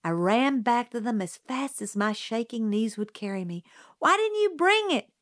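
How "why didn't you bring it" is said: yelling. A narrator's reading. Jim yells angrily at Scout. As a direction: yell the reproach. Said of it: "Jim yelled".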